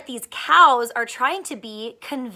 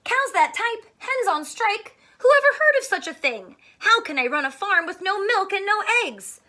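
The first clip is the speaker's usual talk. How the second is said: higher, louder exasperated rant; furious farmer; 7 semitones higher, 3 dB louder than usual. Acted. Farmer rants about typing cows and striking hens, furious. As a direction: rant at the whole farm. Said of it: "Farmer Brown was furious".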